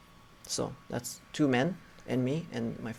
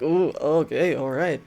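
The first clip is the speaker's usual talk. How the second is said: a mock-startled, louder oh okay, backing off; startled retreat; 3 semitones higher, 6 dB louder than usual. To the furious village elder, a startled louder okay, all right. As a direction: hastily agree to leave after being yelled at.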